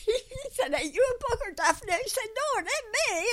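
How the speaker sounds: high, laughing words spilling out; laughing while telling. Real, not acted. Laughing as she quotes the crane man's tease. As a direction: tell it while still giggling.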